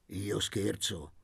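usual speaking voice

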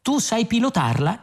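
high-pitched, teasing question; ironic. A narrator's reading. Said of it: "chiese Ford con tono ironico". (domanda ironica, tono acuto)

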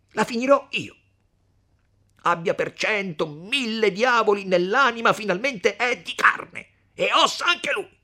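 high, loud raging outburst; hot-headed shouted vow of revenge; furious threat. Acted. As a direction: rage at full voice. Renzo rages in the story.

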